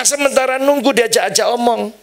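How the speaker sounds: normal talking voice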